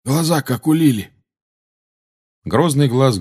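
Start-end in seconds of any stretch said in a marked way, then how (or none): 0.0-1.1 higher-pitched character voice; another wizard's voice. A narrator's reading. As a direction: chime in with a raised, put-on voice. Another wizard adds a remark.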